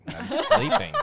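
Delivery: loud protest, pitched high; indignant yelp. Retold or imitated. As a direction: yelp that you're asleep. Partner protesting, woken up.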